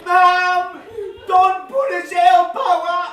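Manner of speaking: high, loud, drawn-out whine; tattling child. Acted. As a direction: holler to Mum like an aggrieved kid. Child tells on brother to Mum.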